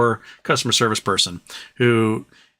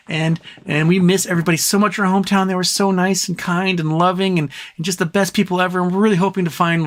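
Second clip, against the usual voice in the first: high, gushing excited couple; hammed-up happy enthusiasm, pitched way up; gushing newcomers; 6 semitones higher than usual. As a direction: gush away as delighted newcomers. Newcomers gushing, acted in parable.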